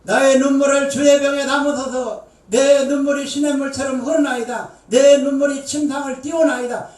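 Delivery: preacher booming the psalm; fervent psalm recitation. Acted. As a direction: proclaim the psalm at full force.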